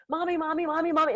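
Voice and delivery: put-on high-pitched child pestering a parent; rapid, repetitive high child whine; nagging little kid. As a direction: imitate a kid begging for attention. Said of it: "It's the little kid in the store".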